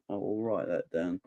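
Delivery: quiet repeat. Real, not acted. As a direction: mutter it as a reminder to yourself. Repeats promise to write it down.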